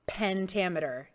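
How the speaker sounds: normal talking voice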